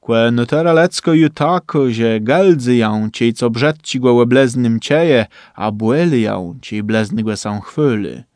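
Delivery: plain reading voice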